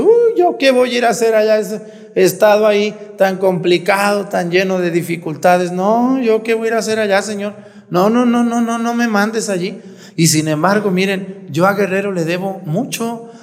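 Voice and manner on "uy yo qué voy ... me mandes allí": higher, complaining voice of a reluctant priest; pleading to be spared the assignment; whiny reluctant protest. Retold or imitated. Priest mimicking himself begging God not to send him.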